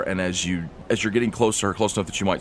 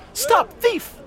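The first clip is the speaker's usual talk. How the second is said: very high, loud cry of alarm; high-pitched acted shout; distant yell; 6 dB louder than usual. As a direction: shriek it as if calling across a crowd. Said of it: "someone about fifty feet away from you yell out".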